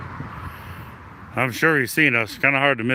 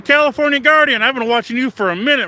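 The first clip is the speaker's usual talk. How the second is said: calling out; 11 semitones higher, 6 dB louder than usual. Real, not acted. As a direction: call out loud and high across the street. Calling out to distant guard.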